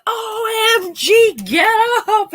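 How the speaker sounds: high, slow, drawn-out OMG; mock-frantic brother waking her up; excited wake-up call. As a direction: squeal it like big news. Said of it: "I wake up to".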